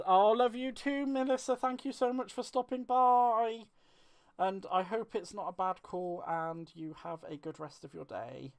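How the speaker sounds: high, loud and bright farewell, slowing on the thanks; gushing goodbye. Real, not acted. To Melissa leaving for a call, a gushing high loud goodbye. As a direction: send her off with big excited warmth.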